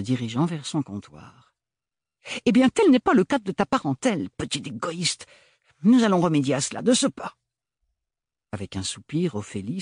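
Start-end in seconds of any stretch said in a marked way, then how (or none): 2.5-7.4 high, loud, commanding; bossy sister. Acted. Agathe bossing her sister, high and firm. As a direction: take charge at once.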